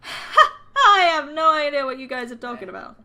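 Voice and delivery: loud, drawn-out wail of bafflement; exasperated confusion. Acted. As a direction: start high and loud, slide down helplessly. Confused player in art argument.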